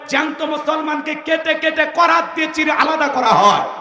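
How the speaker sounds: impassioned, high-pitched sermon oratory; forceful sermon delivery; fiery preaching. Real, not acted. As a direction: preach it out to rouse the crowd.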